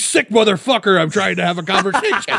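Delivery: a fed-up dog snapping back; exasperated dog voice. Pretend. A dog annoyed that humans won't talk back, indignant. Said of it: "And they're just like".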